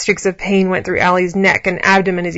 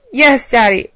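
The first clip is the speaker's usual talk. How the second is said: higher, louder answer; a little louder; 5 semitones higher, 6 dB louder, slower than usual. A narrator's reading. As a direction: say it up, so he hears. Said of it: "Allie repeated a little louder".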